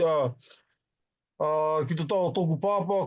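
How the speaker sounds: usual speaking voice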